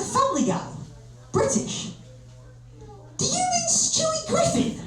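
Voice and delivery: very high, squeaky disbelief; indignant question climbing to a squeak; incredulous. Acted. Comedian to the broker comparing him to a cartoon, squeakily incredulous.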